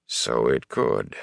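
low, slow, unhurried agreement; low and slow, refusing to argue; laconic reply. A narrator's reading. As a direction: concede it in a deep, drawn-out voice. Tom's unbothered answer to a protest.

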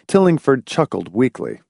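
usual speaking voice

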